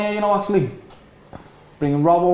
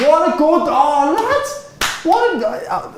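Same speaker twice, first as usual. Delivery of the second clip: excited; 11 semitones higher, much slower than usual. Real, not acted. Excited by a good move.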